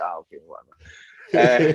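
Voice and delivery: slow, dragged-out, dismissive brush-off; unimpressed whatever. Retold or imitated. Dismissing a fake-Scottish American.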